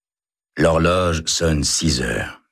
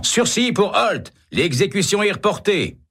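shouted announcement. A narrator's reading. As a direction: cry out the news. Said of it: "en criant".

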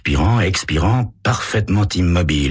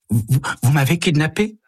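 higher, stuttering feigned shock; stammering surprise; 10 semitones higher than usual. Acted. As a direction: play startled and stammer.

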